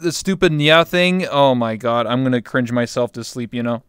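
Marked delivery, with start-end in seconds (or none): 0.0-1.3 teasing bro voice. Retold or imitated. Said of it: "someone's gonna be like".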